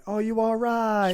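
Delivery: high, slow mock-caring voice; mock concern. Friend asking after a stubbed toe, high slow mock concern. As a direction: coo over a stubbed toe. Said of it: "Just to get the atten".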